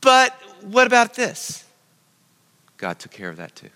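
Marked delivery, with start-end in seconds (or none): 0.0-1.6 higher, louder anxious objection; protesting Moses. Retold or imitated. Preacher playing Moses objecting to God, higher and louder. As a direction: whine it like a reluctant Moses. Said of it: "What did Moses do?".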